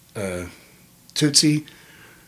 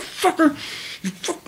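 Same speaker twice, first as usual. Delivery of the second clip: high, strangled cursing that sinks quieter; held-back yell; 3 dB quieter than usual. Retold or imitated. Cursing his opponent without waking anyone, held-back yell. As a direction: yell it under your breath, squeezed high. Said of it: "I'm trying not to yell".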